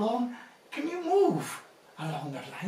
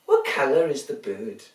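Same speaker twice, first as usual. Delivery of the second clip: ordinary talk, nothing marked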